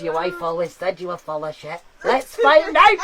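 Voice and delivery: mock-soothing Glaswegian accent; Scottish accent. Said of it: "she's a Scottish woman".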